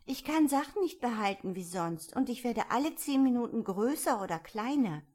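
put-on a touch of childlike complaint; troubled Alice. A narrator's reading. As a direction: confide a worry as young Alice.

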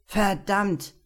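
sharp loud curse; cursing. A narrator's reading. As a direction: swear out loud in fright. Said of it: "fluchte Lukas".

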